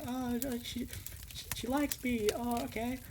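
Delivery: high, bashful stammer; flustered Char. Retold or imitated. Char learns she's liked, flustered and high. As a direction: voice Char caught off guard, way up high.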